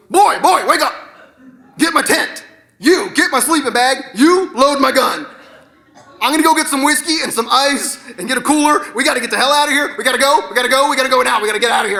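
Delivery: barked orders, rushing toward the end; frantic dad. Acted. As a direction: play a father urgently rousing the kids. Father barks orders at sleeping kids.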